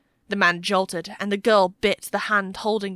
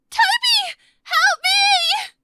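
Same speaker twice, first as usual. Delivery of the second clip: put-on terrified, very high-pitched child's cry; child's scream; 7 dB louder, much slower than usual. A narrator's reading. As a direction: scream for help like a frightened child. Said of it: "the same child voice screamed".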